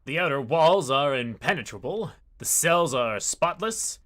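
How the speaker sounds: slow, deliberate narrator read; reading the prison description, slow and even; story narration. Acted. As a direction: let each sentence land slowly. Reads game text slowly.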